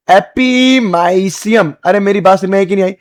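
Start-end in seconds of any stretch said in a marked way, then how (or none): 0.1-1.7 every syllable stretched very slowly; very drawn-out. Shown as a demonstration. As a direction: drag the term right out for students. Teacher stretches new term for class. (बहुत खींचकर, धीरे-धीरे)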